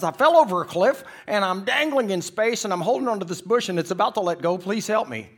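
high, loud, panicked rush; scared, fast talking. Acted. Dangling tourist explaining his fall to the voice above, scared. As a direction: babble out a frantic plea. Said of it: "he's talking faster than me at this point 'cause he's scared".

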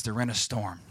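normal talking voice

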